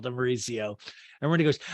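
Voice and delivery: ordinary talk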